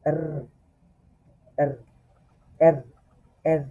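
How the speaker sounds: এর repeated, tongue tapping between র and গ; German R drill. Shown as a demonstration. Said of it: "জিহ্বা একটু বাড়ি দিবেন".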